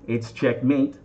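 usual speaking voice

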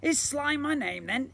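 booming slime creature. Acted. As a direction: play the magical blob, grand and eager.

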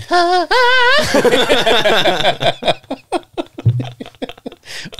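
high singing laugh; sung laugh. Real, not acted.

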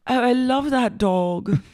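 high, slow, drawn-out fondness; put-on boss gushing. Boss hearing of the dog, put-on gushing. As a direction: gush slowly over the dog. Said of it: "And Lisa goes".

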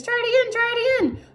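very high, urging goose voice; goose crying out. A narrator's reading. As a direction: urge them on as a high-pitched goose. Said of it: "cried the goose".

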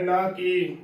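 ordinary talk, nothing marked

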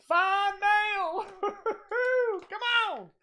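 high, loud whooping hype; excited announcing. Real, not acted. Host hyping fan mail to viewers, excited. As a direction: belt it out high with a laughing whoop.

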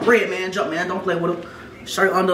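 normal talking voice

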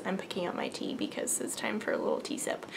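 quiet side comment mid-story; whispered aside. Real, not acted. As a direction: murmur it while picking up the tea. Aside while reaching for tea.

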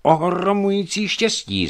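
Čižov exclaiming to the lucky wounded friend; exclaimed. A narrator's reading. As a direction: call it out with amazed delight. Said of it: "zvolal Čižov".

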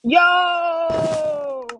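high, loud drawn yo; excited shout. Real, not acted. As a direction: yell it long. Another shout.

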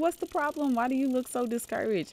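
high, loud cheering of a proud parent; excited cheering. Acted. Dad cheering his daughter on her bike, excited and high. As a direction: cheer the kid on, voice climbing.

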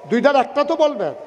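ordinary talk

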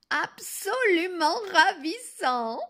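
high, slow, loud rapture; affected delight. Acted. Grandmother plays along with the wolf, gushing. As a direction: stretch it out, gushing falsely.

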